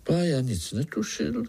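usual speaking voice

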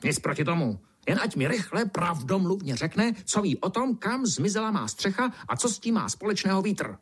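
high, irritable demand; testy, insistent beetle; grumbling beetle. A narrator's reading. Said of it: "brouk zavrčel".

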